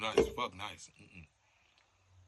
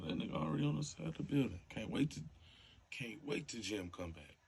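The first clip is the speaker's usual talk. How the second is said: usual speaking voice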